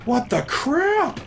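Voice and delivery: high, drawn-out slow squeal; slow shocked what the crap; stunned shriek. Real, not acted. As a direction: disbelief at what just appeared. Creature appears again.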